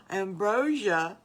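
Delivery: gasp, then loud drawn-out wonder; awed surprise. Acted. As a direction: marvel slowly at an unknown treat. Boys marvel at unknown treat.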